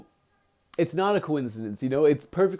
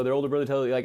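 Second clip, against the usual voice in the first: usual speaking voice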